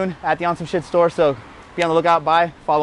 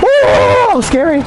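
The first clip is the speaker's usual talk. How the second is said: excited whoop; 12 semitones higher, 6 dB louder, much slower than usual. Real, not acted. Rider lands a scary trick.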